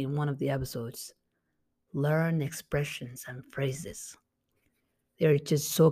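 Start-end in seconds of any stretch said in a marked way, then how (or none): 1.9-4.2 whispered, slow advice. Real, not acted. Teacher whispering the key advice slowly.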